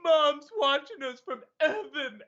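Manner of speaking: high, slow, thick-tongued and teary; tongue-pierced brother voice. Retold or imitated. Quoting the Rat Race brother to the chat, slow, high, tongue-pierced. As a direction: imitate the brother talking round a pierced tongue. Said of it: "he talks like this all the time".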